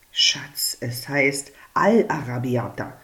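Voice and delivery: whispered, stress on Al; husband whispers a correction to his wife. Acted. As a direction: lean in and whisper the fix. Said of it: "flüstert er".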